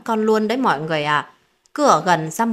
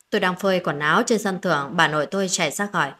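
ordinary delivery

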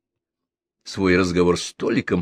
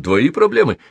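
dismissive and a bit higher; mildly stung; 8 semitones higher, 5 dB louder than usual. A narrator's reading. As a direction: brush him off. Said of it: "его раздражённый тон". (с лёгкой обидой)

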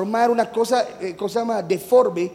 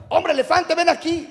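higher, teasing schoolyard call; mock taunting yell; 7 semitones higher than usual. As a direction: call out mockingly like bullying kids. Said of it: "y le gritaban". (grito burlón de niños)

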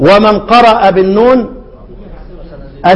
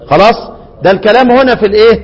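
ordinary talk, nothing marked

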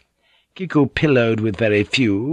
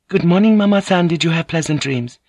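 high, lilting greeting; high, polite young woman; young courtesan; 8 semitones higher than usual. A narrator's reading. Voices Kiku greeting Gyoko.

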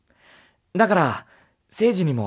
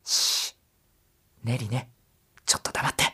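urgent whisper to shush someone nearby; sharp whispered hush; 7 semitones lower, 7 dB quieter than usual. Acted. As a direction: whisper it fast so only she hears. Hushing a companion mid-conversation.

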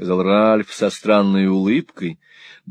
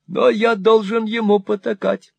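put-on high, wheedling old miser voice; fawning old moneylender; 3 dB louder than usual. Old usurer fawning on Ralph, high and wheedling. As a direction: simper it like a cringing usurer. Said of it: "воскликнул старый Артур".

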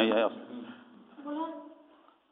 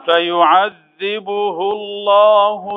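slow, drawn-out melodic tajweed chant; Quran recitation; 5 semitones higher, much slower than usual. Acted. Sheikh reciting for his students, slow melodic chant. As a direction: lay each verse out slowly in a long chanting line.

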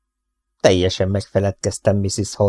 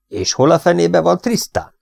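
higher, heated demand, with a falling end on Tristan; angry outburst; 8 semitones higher than usual. A narrator's reading. Boss fuming over missing breakfast and brother, angry. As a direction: let the anger climb on the second question. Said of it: "tört ki belőle".

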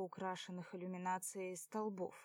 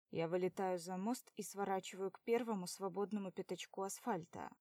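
plain reading voice

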